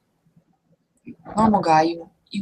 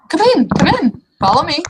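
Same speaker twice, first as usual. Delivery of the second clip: very high, loud eager welcome; high welcoming troll woman; 10 semitones higher, 5 dB louder than usual. Acted. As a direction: usher him in with bright eagerness.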